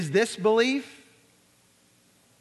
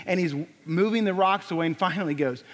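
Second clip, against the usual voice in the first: usual speaking voice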